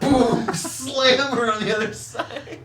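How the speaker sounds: excited. A narrator's reading. GM narrates the slam.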